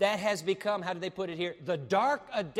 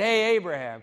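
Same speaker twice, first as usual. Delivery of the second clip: loud hail to someone; calling out; 10 dB louder than usual. Acted. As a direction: call Abraham over with a loud "hey". God calls Abraham over, acted.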